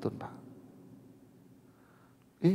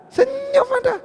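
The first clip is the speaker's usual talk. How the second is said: much higher, louder call to smile; excited urging; 5 dB louder than usual. Real, not acted. Preacher urging husbands to just smile, excitedly. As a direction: lift them up with a bright command.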